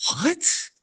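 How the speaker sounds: high, squeaky disbelief; mock shock at something so petty; incredulous. Dad to daughter, squeaking in mock disbelief.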